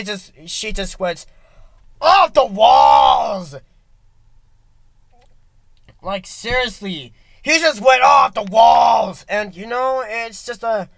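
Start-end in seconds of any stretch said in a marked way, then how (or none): 2.0-3.5 shout. Retold or imitated. Mom's rage. 7.4-9.2 loud. Retold or imitated. Repeat loud.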